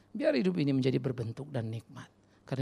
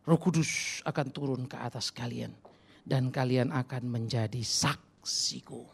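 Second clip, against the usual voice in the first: solemn scripture; slower than usual. Acted. Quoting scripture, slowed.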